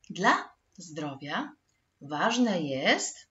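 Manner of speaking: slow, with "jest" rising and left hanging; fill-in-the-blank prompt. Shown as a demonstration. Teacher asking pupils to find the missing word, slow open-ended prompt. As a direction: pause between phrases and lift "jest" for the missing word. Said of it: "poszukaj słowa z ramki".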